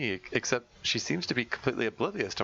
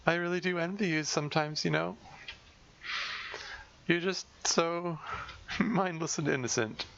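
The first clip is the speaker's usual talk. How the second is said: sardonic; 8 semitones higher than usual. Acted. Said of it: "with a sardonic smile".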